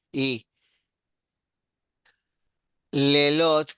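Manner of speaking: ordinary delivery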